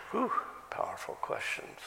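breathy whisper of awe. Real, not acted. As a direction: breathe it out in wonder. Awed aside after questions.

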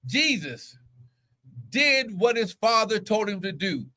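high, drawn-out preacher's cry; full-force preaching. Real, not acted. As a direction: preach it high, leaning on key words.

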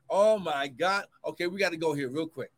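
ordinary talk

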